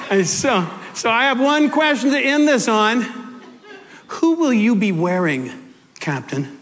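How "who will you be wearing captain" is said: ordinary talk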